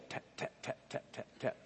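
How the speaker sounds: soft unvoiced tap tap tap, mimicking tiny footsteps; whispered footstep taps. Preacher imitates the office lady's footsteps with whispered taps. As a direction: breathe the taps like a small woman's steps. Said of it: "like that".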